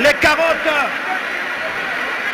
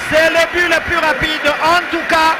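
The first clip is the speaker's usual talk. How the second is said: goal call, raised and shouting; excited sports commentary; 5 semitones higher than usual. Real, not acted. Goal called live on radio.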